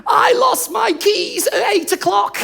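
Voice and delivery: very high, slow fake-weeping wail; hammed-up blubbering confession; mock tearful breakdown. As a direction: squeal it out through pretend tears. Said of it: "in tears and having a breakdown".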